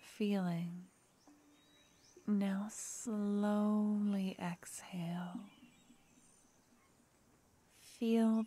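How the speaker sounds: unhurried meditation whisper; guided breathing. Acted. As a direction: lead the breath like a meditation teacher.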